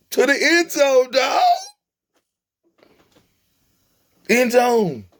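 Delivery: very high, excited holler; high-voiced excited cheer; hyped call-out. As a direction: shout it like a hyped-up fan. Answers own question, calling out destination.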